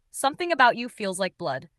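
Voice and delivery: ordinary delivery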